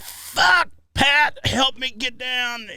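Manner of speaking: high yelled plea, then slow; mock yell. Friend stuck on boat yelling to Pat for help.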